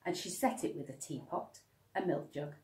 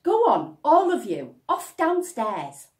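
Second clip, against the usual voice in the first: exasperated mother; 10 semitones higher, 14 dB louder than usual. Acted. Mother shooing children off.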